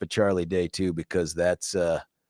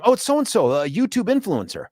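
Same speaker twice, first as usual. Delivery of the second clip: high, imitated starstruck kid; excited kid; 8 semitones higher than usual. Retelling the son spotting an influencer, excited high kid voice. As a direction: mimic a kid spotting someone famous, pitched up. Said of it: "he was as excited as if it was".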